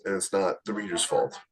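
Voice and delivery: ordinary delivery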